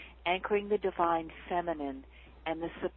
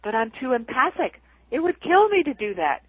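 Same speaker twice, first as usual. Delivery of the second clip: mock overwhelmed whine; 5 semitones higher, 7 dB louder than usual. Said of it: "they're caught because they're like".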